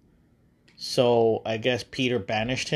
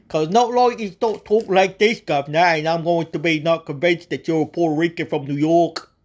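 high, loud mock Cockney British accent; 4 semitones higher, 5 dB louder than usual. Mocking [name]'s accent to the viewers, hammed-up British accent. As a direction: ham up a silly British accent with governor. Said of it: "in a ridiculous British accent".

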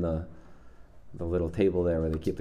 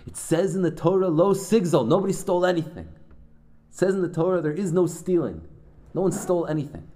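very high, loud furious scream; roaring rabbi rebuke; 10 semitones higher, 6 dB louder than usual. Retold or imitated. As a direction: scream the Torah at him in outrage. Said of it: "he screams at him".

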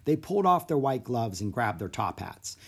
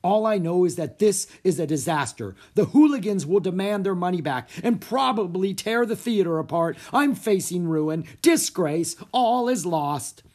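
raised, higher melodramatic rant; despairing cry; 6 semitones higher, 6 dB louder than usual. A narrator's reading. Theater manager faces ruin, crying out in despair. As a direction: lament loudly, slowing toward the end. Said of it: "cried Mister Dewey".

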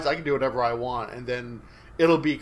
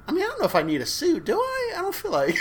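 flustered high voice; faster than usual. Retold or imitated. Bashir voiced, flustered, in retold scene.

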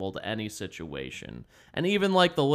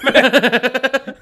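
loud laugh; 11 semitones higher, 10 dB louder than usual. Real, not acted.

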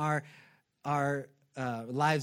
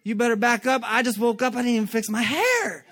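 higher, louder playful push-back; mock sassy reply; 6 semitones higher, 5 dB louder than usual. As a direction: tell her off with a laugh. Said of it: "I'm like".

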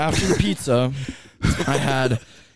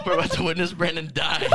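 laughing while talking; 4 semitones higher, 5 dB quieter than usual. Real, not acted. Laughing fit during podcast chat.

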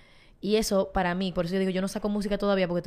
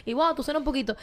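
imitating admiring listeners, pitched higher; impressed fans; 7 semitones higher, faster than usual. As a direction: gush like a fan who just heard you sing. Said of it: "hay gente dice, wow". (imitando a fans asombrados, con voz más aguda)

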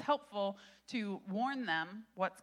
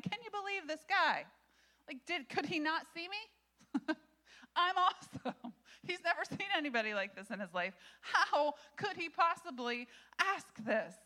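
incredulous angel; 6 semitones higher than usual. Acted. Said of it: "imagine this angel going back to his heavenly host".

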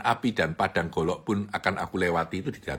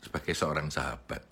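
soft echo; 4 semitones lower, 6 dB quieter than usual. Shown as a demonstration. Speaker echoes his own phrase softly to the audience. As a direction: repeat sebagai seorang sahabat softer and lower.

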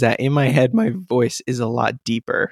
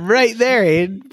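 voice cracking; 8 semitones higher, slower than usual. Real, not acted. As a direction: voice cracks up high. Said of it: "Prime example of my voice cracking".

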